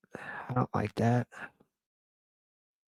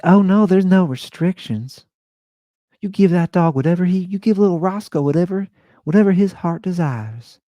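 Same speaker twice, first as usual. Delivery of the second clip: Keith's flamboyant Southern voice; 13 semitones higher, 8 dB louder than usual. Retold or imitated. Keith telling Andy to spoil Roscoe, very high camp Southern voice. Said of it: "this is how he talked".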